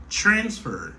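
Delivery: accent the first syllable of transfer, descend on the second; stress on first syllable. Shown as a demonstration. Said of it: "moi, accentué sous première syllabe là".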